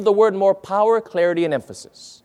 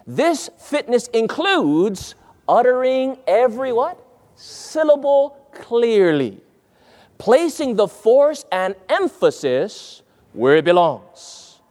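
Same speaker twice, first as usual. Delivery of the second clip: SYLLABLE and CLEARLY stretched out slowly, each part distinct; deliberate enunciation; 4 semitones higher, slower than usual. Shown as a demonstration. Preacher reading a rule on clear speaking, deliberately enunciated. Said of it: "I think that's talking about enunciation".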